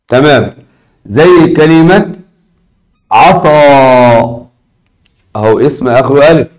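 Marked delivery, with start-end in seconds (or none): 3.1-4.5 final vowel of عصا held very long; drawn-out example word. Shown as a demonstration. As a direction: stretch out the last "aa" of عصا. Writes example word while saying it.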